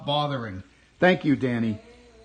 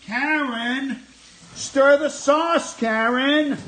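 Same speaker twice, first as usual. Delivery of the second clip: high, loud yell, stretched; nagging partner; 10 semitones higher, 6 dB louder, much slower than usual. Retold or imitated. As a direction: yell at Karen to stir. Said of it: "Goodfellas Karen bit again".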